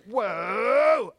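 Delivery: long swooping, loud "whoa"; dramatic whoa. Retold or imitated. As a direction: act out a sudden astonishing release. Acting out the spirit leaving.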